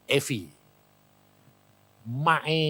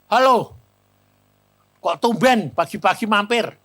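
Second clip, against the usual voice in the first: raised, louder voice of a surprised host; surprised greeting; 4 semitones higher, 6 dB louder than usual. Retold or imitated. Doctor greets the friend who drops by early, surprised. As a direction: greet an unexpected morning caller brightly.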